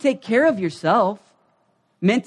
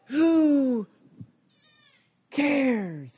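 high, long-drawn-out words with a big pause between; drawn-out, incredulous dismissal; exasperated dismissal; 7 semitones higher, 4 dB quieter than usual. Acted. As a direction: stretch each word out in exasperation. Answering the petty comparing.

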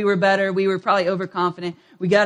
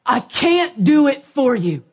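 higher, louder, slow pleading coach; exasperated coach; 5 semitones higher, 4 dB louder, slower than usual. Retold or imitated. Coach pleading with players he can't help, exasperated and slow. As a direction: plead slowly, worn out by the team. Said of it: "he's so exasperated".

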